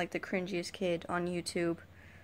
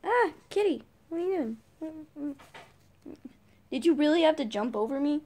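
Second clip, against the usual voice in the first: much higher cooing pet voice; 7 semitones higher than usual. Real, not acted. Talking to her cat.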